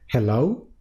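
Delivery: «Hello» subiendo al final como una pregunta. Shown as a demonstration. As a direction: contesta «Hello» sin saber quién llama. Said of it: "un hello así como de pregunta". («Hello» en tono interrogativo, subiendo al final)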